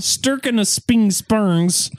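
"stirking of sping spurns" garbled slowly and high on purpose; deliberate spoonerism. Pretend. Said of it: "That's going on the board".

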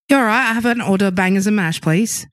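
quick, casual English accent. Shown as a demonstration. Ordering bangers and mash in a contest, English accent. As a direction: put on a chatty English accent. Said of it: "five-second English accent impression".

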